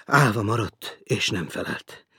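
ordinary delivery, nothing marked

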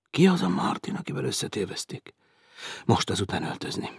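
plain reading voice

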